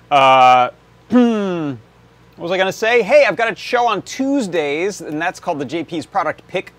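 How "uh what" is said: throat clearing after a coughing fit. Real, not acted. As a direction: clear the throat, still recovering. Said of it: "almost went down the wrong way".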